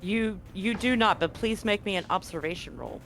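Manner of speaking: ordinary talk, nothing marked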